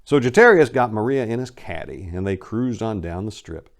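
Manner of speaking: ordinary delivery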